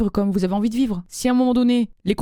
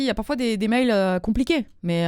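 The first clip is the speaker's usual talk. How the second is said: ordinary delivery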